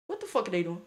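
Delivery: normal talking voice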